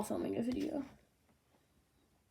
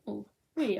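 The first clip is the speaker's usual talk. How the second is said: ordinary talk, nothing marked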